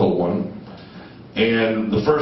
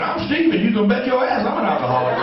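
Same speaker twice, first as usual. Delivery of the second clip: high, eager, laughing declaration; eager mock confession; 11 semitones higher than usual. Young Steven eagerly admitting it to the group, high and laughing. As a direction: blurt it out eagerly to please the room. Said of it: "I said".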